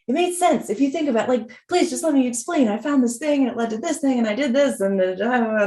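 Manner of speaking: retold pleading. Late again and pleading to explain herself, imitated pleading. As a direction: act out a kid begging to be heard.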